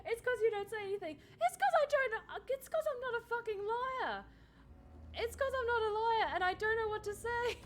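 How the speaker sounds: high-pitched flustered protest. Real, not acted. Streamer defending bad bluffing to teasing chat, high and flustered. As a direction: blurt excuses in a high voice, tripping over words. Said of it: "you're making me flustered".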